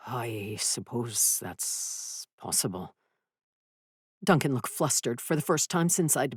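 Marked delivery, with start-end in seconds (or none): 0.1-2.9 quiet, slow, sheepish man; subdued man. Acted. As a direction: mumble it slowly, sheepish. Duncan answering, subdued.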